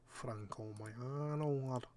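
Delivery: slow, drawn-out Mancunian drawl; light Manc accent, relishing the name. Pretend. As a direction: savour the name like a Manchester lad. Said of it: "good name to say in a Manc accent".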